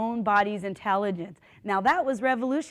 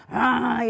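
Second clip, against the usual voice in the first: short imitated labour groan; bear-like growl; 3 semitones higher, 4 dB louder than usual. Rush kicking her in labour, imitated growl. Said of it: "growling like a bear".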